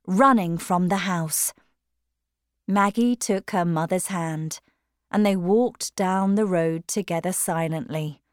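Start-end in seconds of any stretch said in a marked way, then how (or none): none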